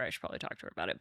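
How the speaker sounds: soft unvoiced whisper to herself; whispered aside. Real, not acted. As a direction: drop to a whisper, as a private note.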